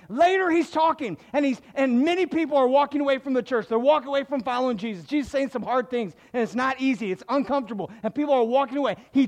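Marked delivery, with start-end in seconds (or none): none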